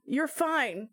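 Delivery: ordinary delivery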